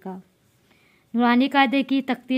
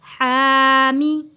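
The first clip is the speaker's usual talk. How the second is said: slow chanted हामी, vowel stretched; Quranic recitation; 4 dB louder, much slower than usual. Shown as a demonstration. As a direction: recite हामी slowly in tajweed style. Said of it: "मीम ज़ेर मी".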